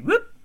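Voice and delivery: sudden high whoop; squeaky whoop. Retold or imitated. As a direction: pop it out high and quick. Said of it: "be like".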